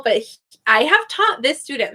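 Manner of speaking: ordinary delivery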